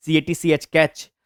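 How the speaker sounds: ordinary delivery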